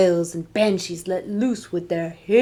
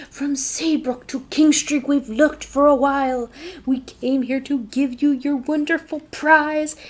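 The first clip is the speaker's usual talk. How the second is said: cheerful monster; 6 semitones higher than usual. Acted. Monster tells child about prize.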